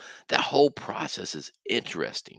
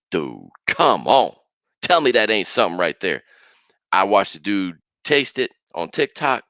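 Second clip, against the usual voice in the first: usual speaking voice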